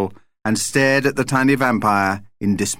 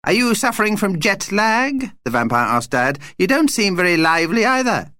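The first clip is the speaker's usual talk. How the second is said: high-pitched vampire questioning; inquisitive little vampire; 9 semitones higher than usual. A narrator's reading. Vampire quizzes Dad on jet lag, inquisitive. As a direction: pipe up nosily, high.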